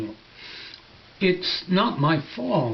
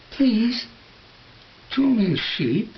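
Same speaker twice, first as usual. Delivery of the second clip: put-on higher, gentle childlike plea; gentle little prince; 6 semitones higher than usual. Little prince gently asking the stranded pilot for a sheep. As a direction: ask very gently, as if it matters. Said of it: "very gently".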